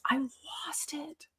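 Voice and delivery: slow breathy whisper; hushed admission. Real, not acted. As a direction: let it out barely audible. Admitting she broke down.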